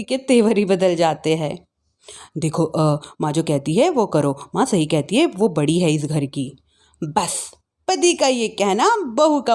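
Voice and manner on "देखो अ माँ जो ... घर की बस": very low, subdued husband voice; husband character. Acted. Husband telling wife to obey his mother, low-voiced. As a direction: lay down the line in a low pitch.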